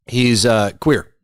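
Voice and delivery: normal talking voice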